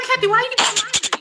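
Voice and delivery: high, quick mock disbelief; retold baffled question. Teacher questioning her dazed student, mock baffled. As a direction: pitch it way up and hurry through.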